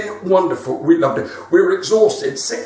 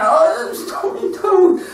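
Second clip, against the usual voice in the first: put-on little girl whimper; 11 semitones higher, slower than usual. Voicing crying little granddaughter.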